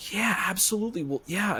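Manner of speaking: high-pitched mock eager agreement; imitating eager yes. Actress agrees to drive up for the film, imitated eager yes. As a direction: quote someone jumping at the offer, voice lifted high.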